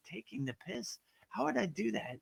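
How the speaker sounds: soft, muttered puzzling over the phrase; hushed, as if thinking it through; confused inner thought. Retold or imitated. Puzzled American hearing British slang, softly to himself.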